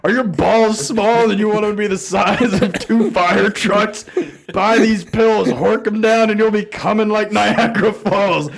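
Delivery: hyped spam ad. Retold or imitated. Pill spam text to [name], hammed-up infomercial shouting. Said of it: "I'd get like".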